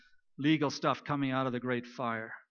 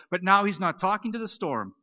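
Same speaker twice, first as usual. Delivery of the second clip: ordinary talk, nothing marked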